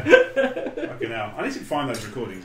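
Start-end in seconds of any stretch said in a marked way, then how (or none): 0.0-1.3 laughing exclaim. Real, not acted.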